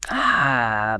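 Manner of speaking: ordinary talk, nothing marked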